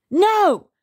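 high, loud scream; screamed refusal. A narrator's reading. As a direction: scream it like a defiant child. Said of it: "screamed Henry".

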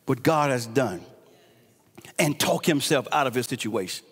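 hushed sermon close. Acted. Preacher quietly pleading with the congregation, hushed. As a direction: bring it down low and confide it.

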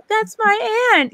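high, drawn-out proud announcement; slow, squealed mock boast; imitated excited claim. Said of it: "you'd be like".

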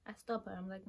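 usual speaking voice

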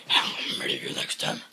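very low mock-menacing growl; growled threat. Voicing the animal threatening murder next time, low growl. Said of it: "she's like".

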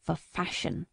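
short, low, quiet reply; a mild curt retort to the question; clipped-off quiet answer. Acted. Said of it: "with a stamp of his foot".

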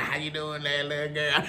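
put-on low, leering old-man voice; creepy old man. Old drunk man calling to a little girl, low and creepy. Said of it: "looking at you like".

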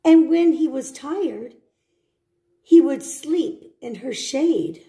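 gentle storybook read; reading to children. Acted. Teacher reading a picture book to children.

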